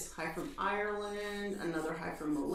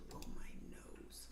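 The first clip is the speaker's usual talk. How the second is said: whispering; 17 dB quieter than usual. Real, not acted. Teasing partner before stream.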